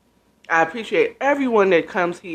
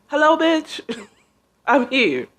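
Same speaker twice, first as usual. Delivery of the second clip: much higher, cheeky brash greeting; sassy music arriving; 6 semitones higher than usual. Retold or imitated. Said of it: "And then it's like".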